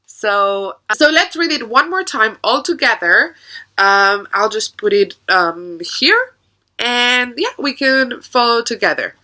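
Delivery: normal talking voice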